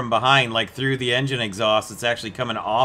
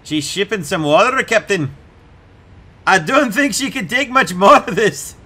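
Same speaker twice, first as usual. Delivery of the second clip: higher, louder worried crewman in a vague put-on accent, rising toward the end; a crewman alarmed in a not-quite-Scottish put-on accent; unplaceable put-on accent; 7 semitones higher, 6 dB louder than usual. Crewman warning the captain the ship is sinking, in a muddled mock accent. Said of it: "No, that is not a Scottish accent. That was an I'm not sure what accent".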